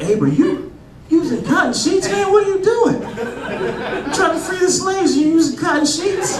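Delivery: high-pitched, indignant scolding; mock outrage. Comic catching Abe Lincoln with cotton sheets, mock outrage. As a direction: confront him, voice shooting way up. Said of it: "I'd be so mad if I found that out".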